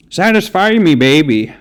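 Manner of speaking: put-on high-pitched, slipping into a Canadian accent. Retrying an [name] lyric, in a Canadian-sounding accent. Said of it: "more like a Canadian accent".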